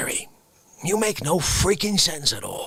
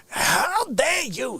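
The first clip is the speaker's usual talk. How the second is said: mild mock outrage; 8 semitones higher, much slower than usual. Mock outrage, third time.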